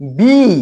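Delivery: बी stressed and drawn out; stressed letter B. Shown as a demonstration. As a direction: lean on बी with a long ee. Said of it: "इसको जोर से".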